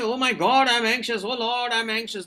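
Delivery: hammed-up anxious outburst; mock panic. Shown as a demonstration. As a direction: act out panicking over nerves. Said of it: "Don't convert that anxiety into panic".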